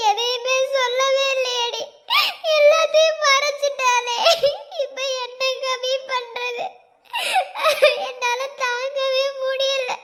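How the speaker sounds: a friend breaking down in tears; weeping. Acted. Friend grieving that Swathi hid her illness, weeping.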